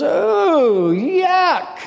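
mock a sweet old lady's disgust; high old lady voice. Preacher mimicking the old lady's reaction, in a high squeaky voice. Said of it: "I hear this sweet lady".

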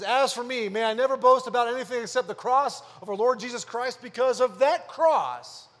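preacher reading scripture aloud with force; preaching scripture reading. Acted. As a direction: proclaim the verse to the congregation. Reads scripture at full force.

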